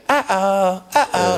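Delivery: high squeaky alien worry. Retold or imitated. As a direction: chirp it like a worried alien.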